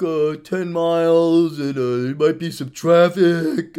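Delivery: mock whiny excuse. Mocking friends who won't drive ten miles, whiny. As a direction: whine like a lazy friend.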